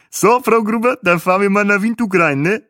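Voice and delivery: high, loud character voice; loud, high-voiced bus guide; Bahe, chipper tour driver. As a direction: pitch it up high, loud and chummy.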